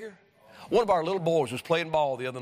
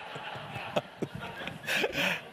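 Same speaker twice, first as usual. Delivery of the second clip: breathy laugh; 7 dB quieter than usual. Real, not acted. Preacher laughs at the story.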